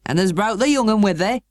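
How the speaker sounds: broad Yorkshire station master. A narrator's reading. Station master greeting the housekeeper in broad Yorkshire. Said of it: "pronouncing his words in a queer, broad fashion".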